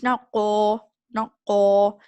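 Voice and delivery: ko said loud with rounded lips, an o vowel; wrong o-sound qaf. Shown as a demonstration. Teacher showing students the wrong rounded ko, loud. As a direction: round the mouth into ko. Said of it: "not the o sound".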